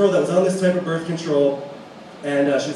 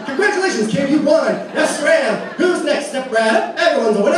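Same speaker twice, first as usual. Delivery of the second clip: lightly voiced fairground barker, higher; carnival barker; 8 semitones higher than usual. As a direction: hawk it like a carnival game host. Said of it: "hand me a giant teddy bear".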